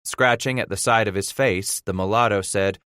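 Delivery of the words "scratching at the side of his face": plain reading voice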